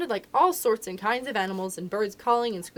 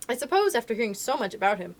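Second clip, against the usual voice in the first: usual speaking voice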